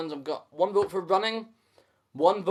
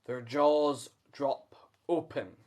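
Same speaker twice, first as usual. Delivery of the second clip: ordinary delivery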